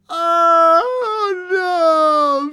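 loud, high, drawn-out wail; wail of misery. A narrator's reading. Wife finding nobody at the door, wail of misery. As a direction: wail out crushing disappointment. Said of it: "a loud wail of disappointment and misery".

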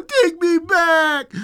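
high, slow, loud wail; hammed-up tearful begging; crying little girl. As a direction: draw it out in a childish wail. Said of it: "cried the little girl".

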